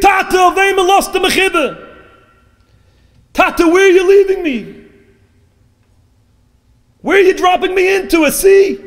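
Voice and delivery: very high, loud shrieking plea; acted shriek. Daughter dropped into the sea shrieks to her father, shrieking. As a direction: shriek it out like an abandoned child. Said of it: "she shrieked".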